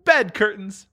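amused high repeat; gloating thief; laughing. Acted. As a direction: relish the answer.

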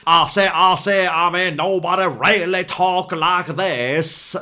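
loud, high, slow Southern drawl; overdone cartoon Southern accent, drawn out; Foghorn Leghorn. Mocking a bad Southern accent as a blustering cartoon Southerner, exaggerated drawl. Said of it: "you do foghorn leghorn".

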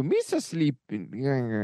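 slow drowsy goofy alien voice; sleepy cartoon creature; Jar Jar Binks. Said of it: "Mister Jar Jar Binks".

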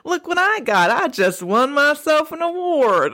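mock old man voice. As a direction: ham up [name]'s old-man impression. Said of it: "Do it".

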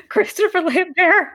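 high-pitched incredulous question through laughter; laughing disbelief. Real, not acted. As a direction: squeal the name back, laughing. Laughing reaction to named bad accent.

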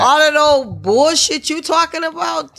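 high-pitched, indignant protest; exasperated comeback. Real, not acted. Host objects to his brother's talk, exasperated.

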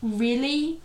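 lightly acted whimpering. A narrator's reading. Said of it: "he whimpered".

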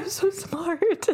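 high, slow, haughty little voice; dog's indignant voice. Retold or imitated. Owner voicing her dog insisting she is smart. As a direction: play the proud dog insisting. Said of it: "She's like".

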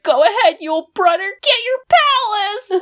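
high, lightly imitated cheer; encouraging. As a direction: send him off with a smile. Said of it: "he's like".

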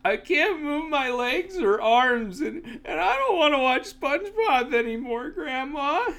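high, hammed-up whining cry; child's wail. Child on couch to grandma, in a high mock wail. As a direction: wail it up high like a helpless, upset kid. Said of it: "I look at her and I go".

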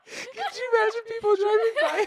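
high, loud, laughing question. Real, not acted. Picturing people driving by, laughing hard. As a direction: squeal it through real laughter. Friends cracking up at photo idea.

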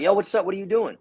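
high, casual bro voice; manager voice. Retold or imitated. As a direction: do the manager, upbeat. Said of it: "texts me like".

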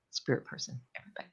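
drops to an unvoiced whisper; fading off into a whisper. Real, not acted. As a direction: breathe the words out with no voice. Trailing to whisper.

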